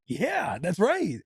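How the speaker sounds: high, swooping cheer; retold delighted dad hype; hyped-up dad. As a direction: cheer your toddler on. Retold hype reply to toddler.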